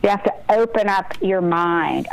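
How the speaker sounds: ordinary talk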